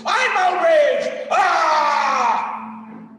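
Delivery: loud, high, hammed-up outraged pundit yelling with laughs; mock outrage rant. Teacher mocks an outrage-bait host for his students, shouting hammed-up fury. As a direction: parody a ranting host, shouting and cracking up. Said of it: "outrage bait".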